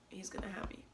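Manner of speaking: breathy unvoiced whisper. Real, not acted. Whispering that her brother has her back, tearful. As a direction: barely voice it. Emotional confession about brother.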